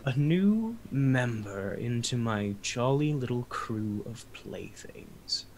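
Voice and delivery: slow, fading into a whisper; sinister whisper. Acted. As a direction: breathe the last words like a threat. DM voicing Strahd.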